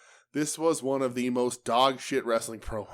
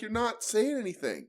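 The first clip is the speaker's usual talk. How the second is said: higher, incredulous retort; exasperated retort; 10 semitones higher, 3 dB quieter than usual. Retold or imitated. Telling the wrestler he's saying nothing, incredulous. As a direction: call him out in disbelief. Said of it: "and I'm like".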